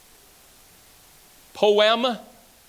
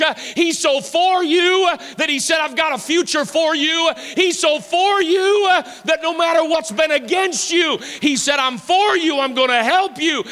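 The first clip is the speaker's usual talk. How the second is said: preaching at full force; 5 semitones higher than usual. Acted. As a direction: preach it harder with each "so for you". Preacher builds to shouted climax.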